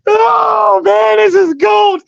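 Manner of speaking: high delighted shout; delighted exclaim. Real, not acted. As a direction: crow it out, thrilled. Laughing delight at own roast.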